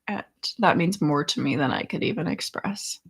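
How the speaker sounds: usual speaking voice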